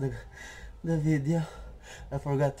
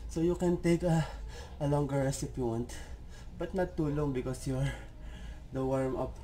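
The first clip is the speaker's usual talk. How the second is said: normal talking voice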